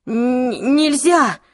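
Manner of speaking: high, loud stammered objection; gasping protest. A narrator's reading. Clary appalled at the killing, gasping protest. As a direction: choke on the start, then burst out. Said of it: "Клэри аж задохнулась".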